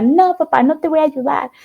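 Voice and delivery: mock-stubborn refusal to her father; stubborn daughter's refusal. Teen refusing father's Christmas request.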